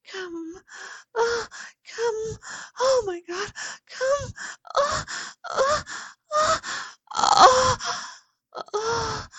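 very high, loud moaning cries; orgasm moans. Acted. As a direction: let the climax peak out. Peak moaning.

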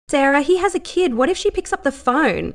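pressing harder with mild anger; a friend getting angrier about a child at risk; a little angrier. A narrator's reading. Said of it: "getting a little angrier now".

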